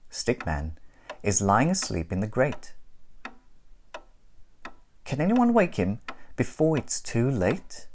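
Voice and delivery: plain reading voice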